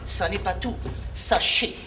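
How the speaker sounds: soft, low confiding to the crowd; conspiratorial hush. Acted. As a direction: draw them in with a hush. Confides to audience.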